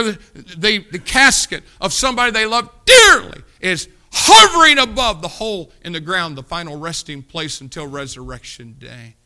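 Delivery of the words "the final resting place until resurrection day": normal talking voice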